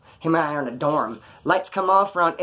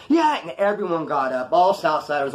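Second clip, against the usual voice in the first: ordinary delivery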